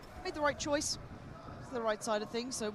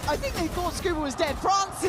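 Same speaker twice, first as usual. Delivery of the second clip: excited shout; 8 semitones higher than usual. Real, not acted. Caster reacting to a clutch play.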